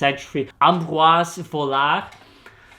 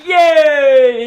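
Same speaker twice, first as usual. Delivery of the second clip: mock cheer; 10 semitones higher, 9 dB louder than usual. Mock cheer after scam claim.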